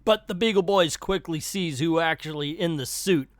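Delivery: usual speaking voice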